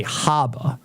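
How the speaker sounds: slow "harbor" as "hahba", Maine style; Maine accent. Shown as a demonstration. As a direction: drop both Rs in "harbor" like a Mainer. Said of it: "They say".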